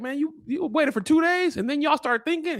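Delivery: high, incredulous scolding in a put-on voice; mock outraged boss. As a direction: chew him out in disbelief, hammed up. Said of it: "And he was like".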